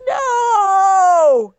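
high, loud anguished cry of no; high mock-anguished cry. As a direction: wail the no in mock despair. Dread at the reply.

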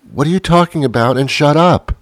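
mock-irritated spouse retort; exasperated. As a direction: cut them off, fed up.

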